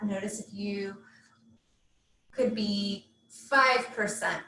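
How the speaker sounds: measured meditation guide, long pauses between phrases; guided meditation. Real, not acted. Yoga teacher settling students into Yoga Nidra, guided meditation. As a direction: guide them gently into rest, leaving space after each instruction.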